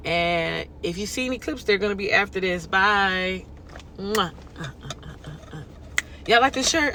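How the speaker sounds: usual speaking voice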